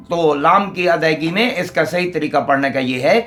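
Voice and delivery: ordinary delivery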